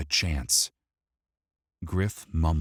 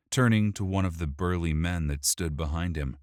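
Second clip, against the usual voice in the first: ordinary delivery, nothing marked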